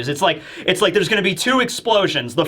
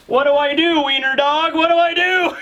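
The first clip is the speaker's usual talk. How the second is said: higher-pitched mock panic; panicked henchman; 9 semitones higher than usual. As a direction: at a total loss, voice rising high. Voices a panicked henchman at a loss.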